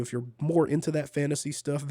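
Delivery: usual speaking voice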